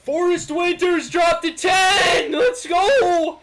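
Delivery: yelling a donation shoutout, slow; hype shout. Real, not acted. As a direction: hype it up at full volume. Streamer reads donation, hyped.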